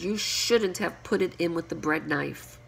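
ordinary delivery

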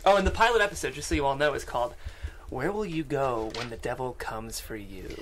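normal talking voice

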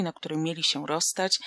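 plain reading voice

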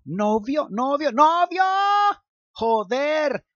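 high, loud, slow frantic cursing; panicked self-scolding. Acted. Luke yelling at his brain for saying boyfriend, frantic. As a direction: yell at your own brain in horror.

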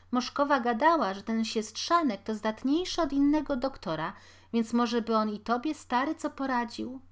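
a poor mother chattering while she works; busy washerwoman. A narrator's reading. Washerwoman to her family, busily and loudly.

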